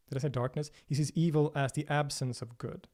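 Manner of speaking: ordinary delivery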